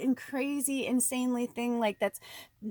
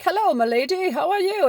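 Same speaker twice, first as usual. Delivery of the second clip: higher, louder courtly greeting; gallant suitor; 9 semitones higher, 8 dB louder than usual. Pretend. Card suitor greeting the lady, courtly and loud. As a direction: greet her like a fancy gentleman. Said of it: "And he's like".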